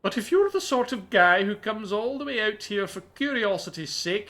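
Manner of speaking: a scholar with a gentle Scottish lilt; slightly posh Scottish. Acted. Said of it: "slightly posh Scottish accent".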